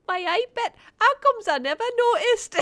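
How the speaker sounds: loud, high mock Geordie; Geordie accent. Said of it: "Credit where credit's due".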